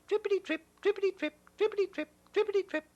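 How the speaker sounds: high, light tripping chant; light little footsteps. A narrator's reading. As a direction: tiptoe the sound high and light. Small goat crossing bridge.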